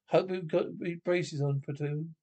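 usual speaking voice

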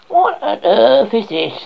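put-on high, slow old woman exclaiming; surprised old woman.

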